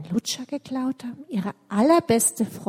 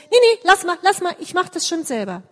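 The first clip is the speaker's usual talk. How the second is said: flustered refusal; 8 semitones higher, 6 dB louder, faster than usual. Acted. Michelle hides the stolen lollipop.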